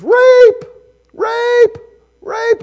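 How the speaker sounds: high, loud repeated screams; screaming. Acted. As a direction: scream it like the accusing wife. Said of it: "And she screams".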